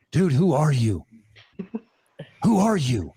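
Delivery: imitating a startled man, pausing before asking twice; mildly stunned retelling of his question; retold astonishment. Startled coworker to the storyteller after jumping back, retold astonishment. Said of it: "the look on his face".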